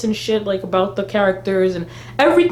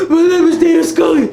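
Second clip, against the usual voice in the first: higher, louder, tearful shout, hammed up; mock Scully cry; 7 semitones higher, 6 dB louder than usual. Mocking the show's panting scene as a crying Scully. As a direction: overplay Scully crying out her own name.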